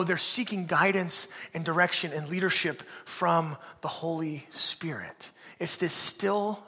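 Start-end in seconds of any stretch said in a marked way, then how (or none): 3.8-5.3 reverent whisper. Acted. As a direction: whisper the name slowly. Hushed reverence for Holy Spirit.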